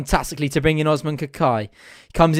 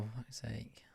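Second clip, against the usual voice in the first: whispered, very soft curse; dismayed curse; 5 semitones lower, 25 dB quieter than usual. Real, not acted. Fan seeing the scoreline, dismayed whisper. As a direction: swear under the breath, voice dropped away.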